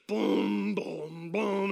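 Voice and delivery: low, drawn-out boom; imitated booming footsteps. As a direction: boom it like a giant lumbering in. Giant wrestler's heavy entrance imitated.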